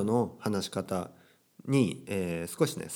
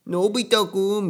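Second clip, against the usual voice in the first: high, loud cartoon call; Doraemon; 10 semitones higher, 6 dB louder than usual. Robot cat calling his friend, high whiny imitation. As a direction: wail the name in a put-on anime voice. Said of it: "そんな話し方".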